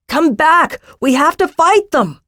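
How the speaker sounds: high, urgent rallying cry. A narrator's reading. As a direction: call them back to fight. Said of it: "Jakaran cried".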